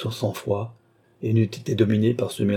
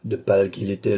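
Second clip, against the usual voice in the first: ordinary delivery, nothing marked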